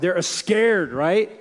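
ordinary talk

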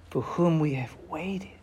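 whispered, almost breathed; a hushed, awed whisper; reverent whisper. Real, not acted. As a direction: let the last words fall to a whisper. Reverent close of the verse.

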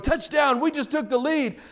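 high, excited touchdown shout; excited cheer. Retold or imitated. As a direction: voice the players' celebration. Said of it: "arms up going".

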